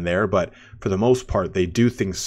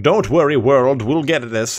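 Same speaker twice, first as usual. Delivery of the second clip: louder, higher, grand heroic delivery; elf voice acting; 6 semitones higher, 7 dB louder, slower than usual. Elf hero rallying the world, grand and loud. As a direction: loudly reassure the world, drawn out at the end. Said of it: "I love their voice acting".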